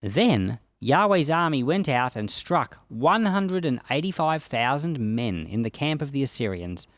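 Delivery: ordinary delivery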